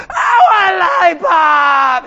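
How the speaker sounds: slow, high, loud crying whine; bratty child crying for candy; whining child. Retold or imitated. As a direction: beg for it like a kid. Child begging at register.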